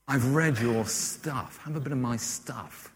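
slow, softened drawly author; Martin Amis drawl. Retold or imitated. Famous novelist to a younger writer, soft slow drawl. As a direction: put on a writer's languid drawl. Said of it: "quite a sort of drawly voice".

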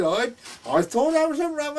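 high-pitched, spluttering astonishment; flustered disbelief. Retold or imitated. Friend realising his mistake in the shallot judging, flustered. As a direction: splutter it out as the penny drops.

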